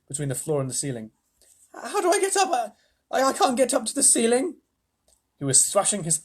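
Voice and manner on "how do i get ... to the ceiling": put-on high boyish voice, fretting; a boy who can't get up; worried boy. Charlie stuck midway, asking Wonka for help, high and anxious.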